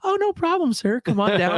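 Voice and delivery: high, eager-to-please clerk; mock-cheery customer service; mock obliging. Club clerk suddenly welcomes caller.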